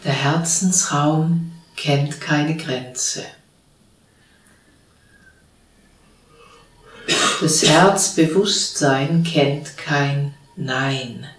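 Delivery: calm, unhurried meditation voice with long pauses; guided meditation. Real, not acted. (ruhige Stimme einer geführten Meditation, mit langen Pausen)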